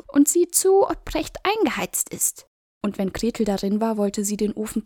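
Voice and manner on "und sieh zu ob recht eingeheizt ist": high, loud scheming witch. A narrator's reading. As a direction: push her on with false sweetness. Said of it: "sagte die Hexe".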